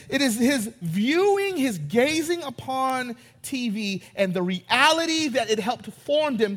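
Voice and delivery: ordinary talk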